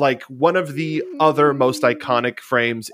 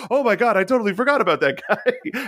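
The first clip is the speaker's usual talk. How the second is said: laughing mock realization; 8 semitones higher, faster than usual. Luffy suddenly remembering brother.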